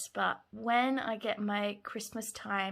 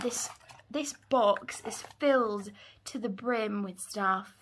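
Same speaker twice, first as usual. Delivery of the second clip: ordinary delivery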